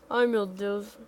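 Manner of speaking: usual speaking voice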